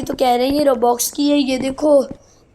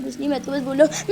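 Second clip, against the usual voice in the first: ordinary delivery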